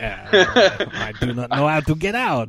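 hammed-up French Canadian accent; French Canadian Bergeron impression. Talkative mime to an audience, French Canadian accent. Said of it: "does not sound like that".

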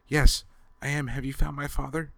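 put-on high, eager girl's voice; a young girl bursting with hope; kidnapped girl asking her rescuer about her father, eagerly. A narrator's reading. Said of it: "the girl cried eagerly".

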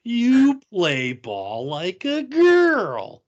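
high, loud, slow drawn-out taunt; kid's taunt. Retold or imitated. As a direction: stretch the insult out like a schoolyard jeer. Quoting Sandlot taunt.

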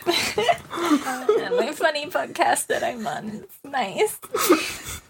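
mock cheerful. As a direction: pretend it's all fine.

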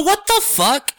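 high-pitched indignant disbelief; retold shocked protest; shocked outrage. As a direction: react aghast, pitch jumping well up. Reacting to insult hurled at friend.

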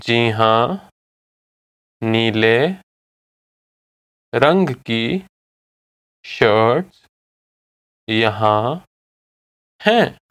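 one word at a time, long gaps between; slow repeat. Shown as a demonstration. Teacher models shopkeeper's answer for listener to repeat, slowly. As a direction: spell out the sentence slowly, word by word. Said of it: "I'll say it again slowly".

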